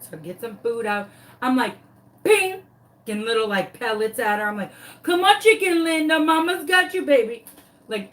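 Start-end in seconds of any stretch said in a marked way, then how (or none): 5.0-7.4 high, sweet coaxing call; coaxing baby talk. Retold or imitated. Luring the chicken out from under the house, high coaxing coo. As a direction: coo her out like a mama to her baby. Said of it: "trying to lure my sweet baby chicken".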